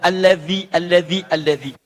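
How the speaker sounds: tongue between teeth in Allazi; dhal in allazi. Shown as a demonstration. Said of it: "kalau mengucapkan zal". (Allazi dengan zal lidah digigit)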